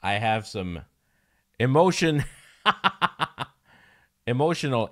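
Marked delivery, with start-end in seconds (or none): 1.6-4.9 laughs through the word, high and loud; laughing. Real, not acted. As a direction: giggle it out. Laughing while admitting emotional issues.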